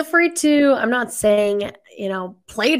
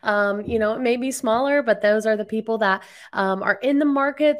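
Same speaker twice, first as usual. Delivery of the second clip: ordinary talk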